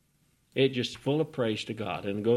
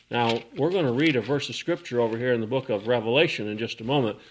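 ordinary talk, nothing marked

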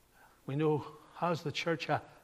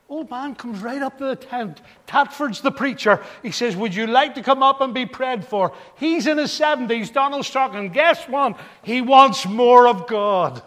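loud, raised-pitch storytelling from the pulpit; impassioned preaching; 7 semitones higher, 12 dB louder than usual. Real, not acted. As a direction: build the anecdote to an emphatic climax. Preaches through a rousing anecdote.

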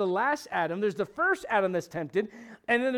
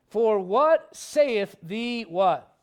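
call and response; 5 dB louder, much slower than usual. Acted. Preacher prompting congregation response.

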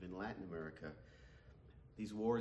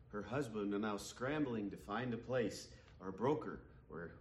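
ordinary talk

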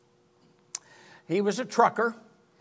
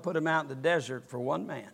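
ordinary talk, nothing marked

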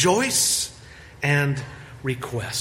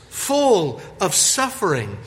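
ordinary delivery

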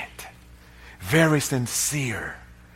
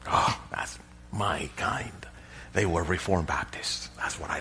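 mock whisper; 8 semitones lower, 10 dB quieter than usual. Preacher mocking smug traditional worshippers, whispered. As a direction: hush it like a self-satisfied insider.

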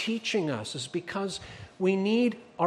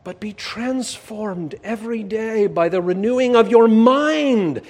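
full-force preaching; 3 semitones higher, 8 dB louder than usual. Acted. Pastor proclaiming scripture to the congregation, full-force preaching. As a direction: proclaim it to the back pews.

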